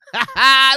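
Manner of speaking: sudden loud cackle; a loud, mocking burst of laughter. Real, not acted. Laughing hard at the beaten suspect on screen, loud cackle.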